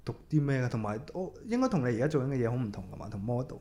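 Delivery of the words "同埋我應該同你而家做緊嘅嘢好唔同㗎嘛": ordinary talk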